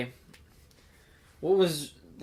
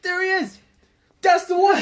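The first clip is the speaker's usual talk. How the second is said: higher and louder, thrilled shout of recognition; excited mock cheer; 11 dB louder than usual. Fan spotting the old Doctor, excited shout. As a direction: cheer like you just spotted your favorite. Excited retold reaction to cameo.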